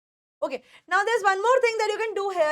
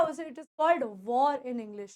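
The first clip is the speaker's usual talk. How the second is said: usual speaking voice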